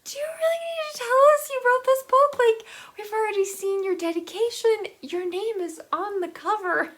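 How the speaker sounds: a fast, high sarcastic rant at the author; mocking exasperation. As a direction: scoff at the author in a shrill mocking voice. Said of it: "Just like".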